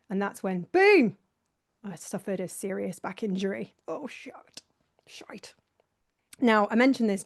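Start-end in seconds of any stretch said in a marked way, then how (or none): 0.7-1.2 loud, high burst on boom; sudden boom. Real, not acted. Host describing his injury, loud high boom. 3.9-5.5 unvoiced whisper; whispered curse. Real, not acted. Host recalling his back injury, whispered curse.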